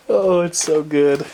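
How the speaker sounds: slow, drawn-out delighted gush; awed. Real, not acted. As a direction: savor every word in awe. Gushing over the lights.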